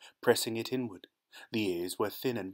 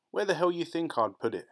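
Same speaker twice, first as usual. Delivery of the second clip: higher-pitched exasperated retort; irritated; 7 semitones higher than usual. Acted. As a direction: answer back as if it were obvious. Claude snaps back at questioning.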